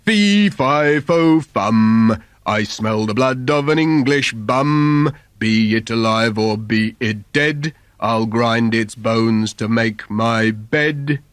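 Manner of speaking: loud, slow booming giant chant; giant roaring. Acted. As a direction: boom it out like a menacing giant. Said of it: "the giant roared out".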